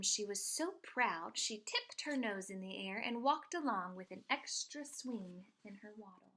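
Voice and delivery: ordinary delivery, nothing marked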